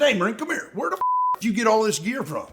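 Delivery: high, amazed question; mock disbelief at the gear; high-pitched retold disbelief; incredulous. Retelling his own question to a Marine.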